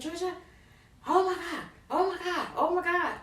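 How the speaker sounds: high, frantic imitation of a panicking mother; retold panicked scream. Mother finding her daughter giving birth on the floor, imitated panicked high cries. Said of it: "she was just screaming".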